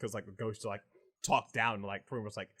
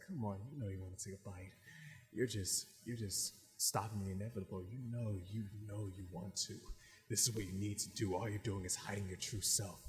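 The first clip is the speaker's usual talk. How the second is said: low, hushed tempting dark side; cocky, sadistic inner demon; 4 semitones lower, 10 dB quieter than usual. Acted. Dark side urging him to take a bite, cocky and hushed. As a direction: coax softly like a sinister inner voice. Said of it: "way more, like, cocky and sadistic than him".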